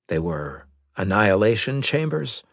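ordinary delivery

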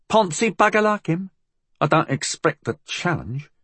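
scoff, cocky; cocky Cockney girl. A narrator's reading. Molly scoffs.

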